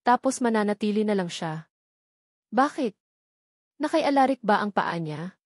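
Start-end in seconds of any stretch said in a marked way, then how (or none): none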